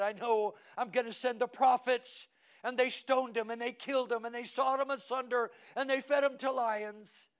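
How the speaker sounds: loud, high-pitched pulpit proclamation; full-force preaching. Acted.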